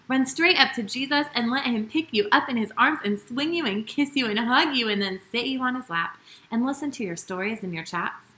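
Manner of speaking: ordinary talk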